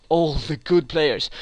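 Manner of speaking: usual speaking voice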